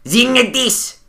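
high-pitched mock German; hard German accent. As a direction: force it out as exaggerated German. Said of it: "do German and do it as, like, as, as hard as possible".

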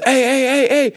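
repeated high-pitched interjections; mock protest. Moviegoer breaks into the cursing, mock protest.

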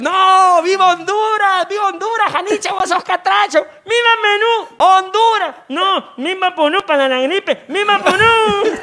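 excited crowd shouting. Acted. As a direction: shout like fans in a match. (gritos de hinchas, agudos y fuertes)

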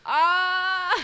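high, loud squeal of joy; delighted squeal. Real, not acted. A mother melting at "Mamá, amo", squealing.

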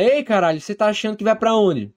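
high-pitched, indignant challenge; angry father. Acted. Father confronting his daughter in a row, angry. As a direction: demand an answer in a high, heated voice. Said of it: "O pai dela".

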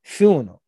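"funeral" said as "fee-oona", run together as one phrase; Gullah "fi oonuh". Shown as a demonstration. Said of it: "And this is for you".

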